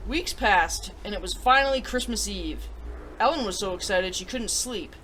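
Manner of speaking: gentle storybook reading; storybook narrator voice; story narration. Acted. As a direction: read it like a bedtime story to kids. Story read aloud to viewers.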